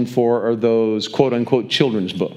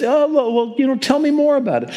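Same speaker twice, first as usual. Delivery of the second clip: mock innocent; 12 semitones higher than usual. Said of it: "He just says".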